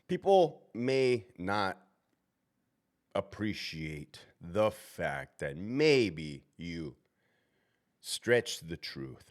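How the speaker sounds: slow, deliberate. Real, not acted. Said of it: "slow emphatic phrasing".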